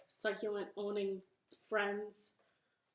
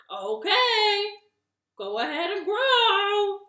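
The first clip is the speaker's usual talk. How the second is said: loud, high order with drawn-out words; shouted command; 11 semitones higher, 14 dB louder, slower than usual. Acted. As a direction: holler at the seeds, stretching it out. Toad orders his seeds to grow.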